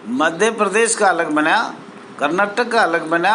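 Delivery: ordinary talk, nothing marked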